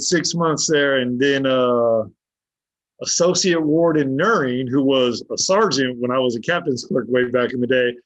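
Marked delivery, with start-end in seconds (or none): none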